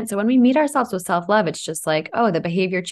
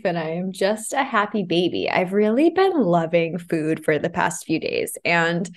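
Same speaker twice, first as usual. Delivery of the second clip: ordinary delivery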